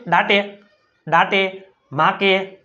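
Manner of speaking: દાટે and માકે read letter by letter, long 'aa' and voiced final 'e'; mock misreading. Shown as a demonstration. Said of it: "આપણે કોઈ, કોઈ દી આને વાંચ્યું?". (દાટે, માકે એવું ખોટું વાંચન)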